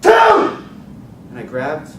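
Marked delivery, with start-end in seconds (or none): 0.0-0.6 high, very loud countdown yell; yelling threat. Retold or imitated. Carjacker counts down to threaten the teens, furious yell. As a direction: scream the countdown at them.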